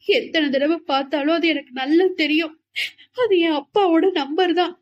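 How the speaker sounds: crying but certain; still crying. A narrator's reading. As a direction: keep weeping while insisting. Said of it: "தன் அழுகையை தொடர்ந்தாள்".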